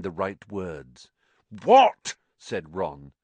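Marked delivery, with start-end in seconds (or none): 1.6-2.2 loud sharp high What; impatient shout. A narrator's reading. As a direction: bark the question out.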